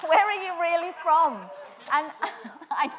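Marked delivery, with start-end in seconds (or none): 0.1-1.5 the question pushed up loud and high, a touch mocking; hammed-up retold questioner, raised; louder, higher mock questioner. Retelling the man at church's question, loud and high. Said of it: "they said".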